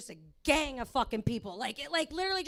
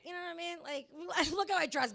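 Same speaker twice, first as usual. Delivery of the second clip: ordinary talk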